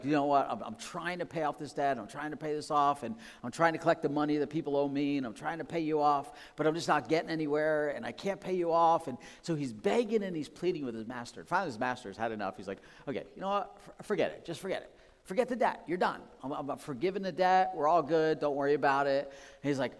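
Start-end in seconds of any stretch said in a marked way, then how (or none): 0.0-9.1 pleading servant. Acted. 13.1-19.3 higher, louder big-hearted release; forgiving master. Acted.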